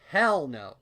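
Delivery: a sudden loud recoil of disgust; emphatic outburst. Acted. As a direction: blurt it out loud and high. Narrator blurting about butterflies.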